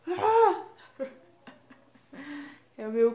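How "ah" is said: high, loud delighted squeal; excited squeal. Real, not acted. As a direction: squeal with glee over the crush. Grito de empolgação sobre o crush. (gritinho animado e agudo)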